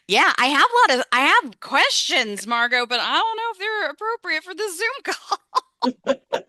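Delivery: barely holding back a laugh. Real, not acted. Host teasing Margo on the Zoom call, giggling.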